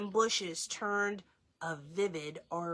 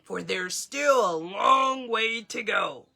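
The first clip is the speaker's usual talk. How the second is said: louder, put-on voice for the watchdog; gruff dog voice; 6 dB louder than usual. Tok urging everyone onward, loud. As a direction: bark it out like a steady old dog.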